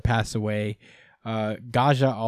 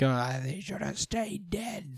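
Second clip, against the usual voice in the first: mock complaining fan; 7 semitones higher, 8 dB quieter, slower than usual. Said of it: "I have no reason to be like".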